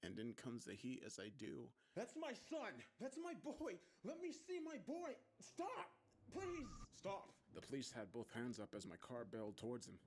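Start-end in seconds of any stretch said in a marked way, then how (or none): 1.9-7.3 frantic, very high-pitched pleading; desperate shouting. A narrator's reading. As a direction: cry out as if being dragged away. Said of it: "The shouts continue".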